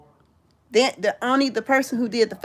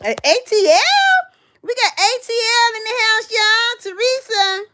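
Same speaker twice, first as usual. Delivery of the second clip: much higher and louder hype call; excited shout-out; 12 semitones higher, 4 dB louder than usual. Real, not acted. Welcoming Atlanta viewers into the live chat, high excited whoop.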